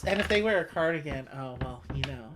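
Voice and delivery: ordinary talk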